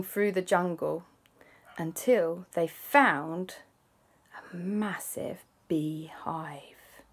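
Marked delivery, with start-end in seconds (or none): 4.4-7.0 hushed storyteller whisper. A narrator's reading. Narrating to kids, slow hushed whisper. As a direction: breathe it like a secret reveal.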